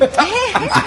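a bright burst of real laughter; laughing. Real, not acted. Storyteller laughing at the third Vali.

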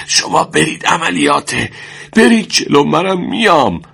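high, strained urging to go on; wounded soldier pleading. A narrator's reading. Wounded man urging his comrade ahead, strained and high. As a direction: push them forward through the pain.

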